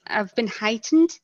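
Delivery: ordinary delivery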